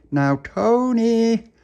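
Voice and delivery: long, high calling shout; loud, drawn-out doorstep call; shouting through letterbox. Acted. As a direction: holler for a friend, stretching the name. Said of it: "shouted through the letterbox".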